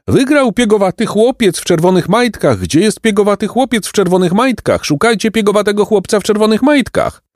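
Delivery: very high-pitched imitated crowd cries; crowd calling out. People hunting for the freckled boy, high imitated shouting. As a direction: mimic excited searchers in a high, thin-pitched call. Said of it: "jak ludzie krzyczą".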